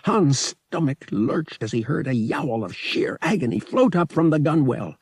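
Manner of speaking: ordinary delivery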